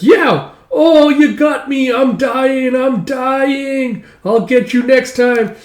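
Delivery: high, drawn-out mock death wail; hammed-up kid's death cry; pretend dying. Child struck in play battle, pretend dying. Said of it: "are all playing pretend".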